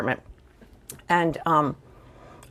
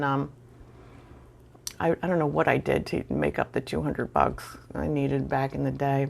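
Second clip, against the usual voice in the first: ordinary delivery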